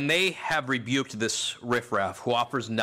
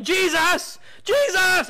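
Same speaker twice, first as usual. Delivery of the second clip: high, loud cry for attention; yelling out; 8 dB louder, much slower than usual. Retold or imitated. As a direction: call out over a crowd at full voice. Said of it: "this man's out there yelling".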